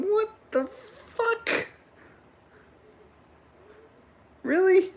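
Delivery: squeaky drawn-out disbelief; imitating Pete. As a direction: play a stunned friend hearing bad news. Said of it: "Probably sitting there going".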